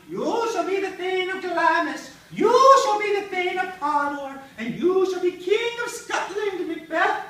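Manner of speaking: high, drawn-out witch proclamation; witches' prophecy. Acted. Witches hail Macbeth with his future, high drawn-out chanting.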